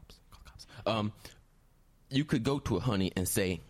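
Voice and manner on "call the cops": urgent whispered plea; whispering. Acted. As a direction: hiss the request under your breath. Hostage whispers aside.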